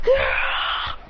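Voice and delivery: high, strained yell at contained volume; high battle cry; acted yell. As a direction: yell as flames burst out. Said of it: "As he yells".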